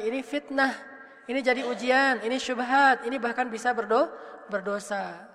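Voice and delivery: ordinary delivery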